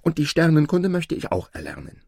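put-on high, loud boyish enthusiasm; eager boy. A narrator's reading. Aladin telling the stranger his ambition, eager. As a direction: add the wish keenly, high and loud. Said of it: "rief Aladin".

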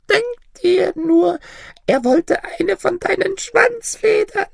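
very high, loud crone voice; high, loud little granny in mock outrage; scandalised old granny. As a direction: blurt the news in a piping old woman voice. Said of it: "fragte das Mütterchen".

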